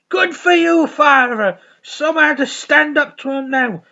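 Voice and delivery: high loud mock praise; hammed-up approving priest; character voice. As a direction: cheer him on in a high loud voice. Acted Father Ted dialogue.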